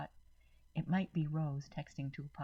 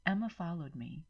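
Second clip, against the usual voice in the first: usual speaking voice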